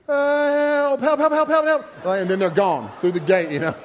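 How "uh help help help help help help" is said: high, loud frantic calls for help; mock panicked kid cry. Child entering the dinosaur ride, hammed-up panic. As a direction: yelp it like a scared little kid. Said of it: "one of 'em looks over and goes".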